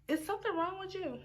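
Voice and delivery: high sassy question. Retold or imitated. Her sassy retort to boyfriend.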